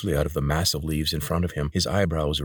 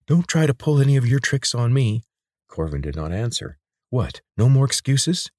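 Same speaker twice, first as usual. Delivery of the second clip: taunting; 7 semitones higher, 4 dB louder than usual. Acted. Lena taunting, hostile.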